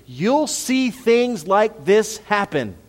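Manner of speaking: each word stretched out slowly and high; slow it right down and lean on every word; slow emphatic preaching. Acted. Pastor promising the congregation, slow emphatic preaching.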